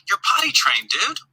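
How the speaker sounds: high, pleading scold of a frustrated dad; frustrated pitch-up at the toddler; exasperated. Retold or imitated. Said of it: "And I'm like".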